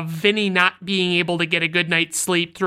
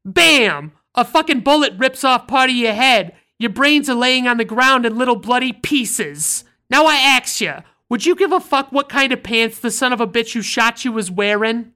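louder, higher New York tirade; loud New York accent; 4 semitones higher, 4 dB louder than usual. Retold or imitated. To Vinny worried about his pants, loud New York tirade. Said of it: "That's the best I can fucking do".

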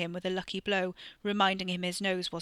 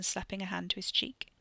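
usual speaking voice

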